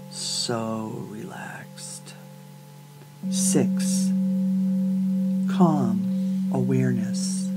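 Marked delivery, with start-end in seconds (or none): 0.1-2.1 very soft, slow, near-whisper; hushed relaxation cue. Acted. Guide counting listeners down into rest, hushed and slow. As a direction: let it sink away, drawn out and hushed.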